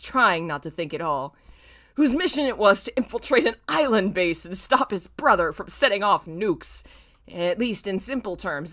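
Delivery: ordinary delivery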